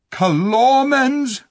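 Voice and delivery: slow, high, loud outraged roar; thundering. Acted. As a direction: bellow the word in disbelief. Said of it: "thundered Tirian".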